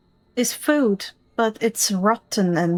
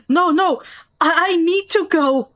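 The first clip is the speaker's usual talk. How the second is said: panicked villager; 6 semitones higher than usual. Acted. Villager begs to reach his baby.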